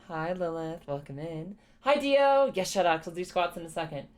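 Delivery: normal talking voice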